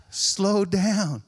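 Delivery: high, loud, insistent answer; exasperated repeat. Retold or imitated. Exasperated neighbour repeats answer louder.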